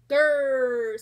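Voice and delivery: a loud, rough growl; rhino growl. Acted. Rhinoceros growls at the small porcupine, loud growl. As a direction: growl it out like a big beast. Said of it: "said the rhinoceros".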